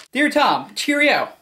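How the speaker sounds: chirpy mock British greeting; English accent. Reading Spielberg's letter aloud in a mock English accent. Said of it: "Steven Spielberg writes with an English accent".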